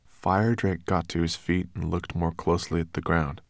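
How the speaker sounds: ordinary delivery, nothing marked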